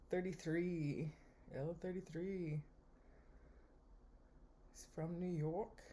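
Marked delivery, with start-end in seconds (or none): 4.7-5.8 bad New York accent. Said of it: "That was a bad accent".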